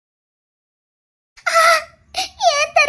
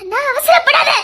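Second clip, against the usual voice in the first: urgent plea; 4 semitones higher than usual. Acted. Sister stops brother's rash decision.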